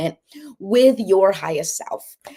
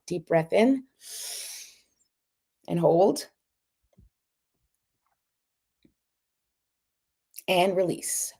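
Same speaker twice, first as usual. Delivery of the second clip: guided breathing; 3 dB quieter, slower than usual. Shown as a demonstration. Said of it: "let's do it one last time".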